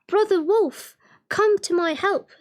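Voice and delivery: a fox calling desperately to his brother; crying out for help. Acted. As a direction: fox crying out to the wolf for rescue. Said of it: "cried with all his might".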